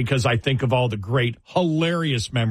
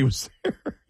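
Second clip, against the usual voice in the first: laughing; 4 dB quieter than usual. Real, not acted. Laughs mid-word.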